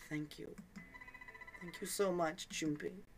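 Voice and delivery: a hint of tearful gratitude; trembling voice. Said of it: "Her trembling voice".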